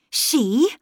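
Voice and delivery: gasped echo. A narrator's reading. Governess amazed at the boy's word, gasping it back. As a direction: gasp the word back as a question. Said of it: "I gasped too, and repeated it".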